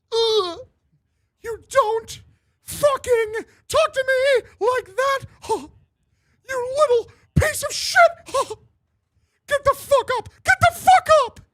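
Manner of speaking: enraged, very high shrieking mouse; Mickey Mouse. Acted. Mickey beats up the [name], furious high shouting. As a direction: scream it in a furious cartoon falsetto. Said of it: "where he's fucking up the [name]".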